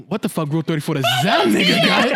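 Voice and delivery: loud, laughing shout; laughing disbelief. Retold or imitated. Reacting to the president's Rule 34, laughing shout. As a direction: yell it through laughter. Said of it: "I'd be like".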